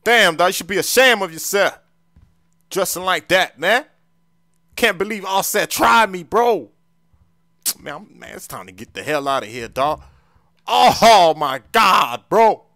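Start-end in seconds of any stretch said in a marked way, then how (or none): 0.0-6.7 loud, high-pitched outrage; indignant scolding. Acted. Scolds visitor, feels tricked. 10.7-12.6 loud, high cry of disbelief; exasperated outcry. Acted. Outraged at being set up.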